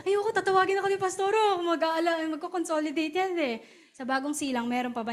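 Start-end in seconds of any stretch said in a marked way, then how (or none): 0.1-3.6 quick, high and whiny refusal; reluctant younger self. Retold or imitated. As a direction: hurry to dodge being called by the pastor. Her past self avoiding follow-up.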